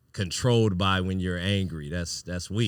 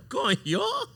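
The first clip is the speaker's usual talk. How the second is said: startled exclamation. Retold or imitated. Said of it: "That was the perfect coño".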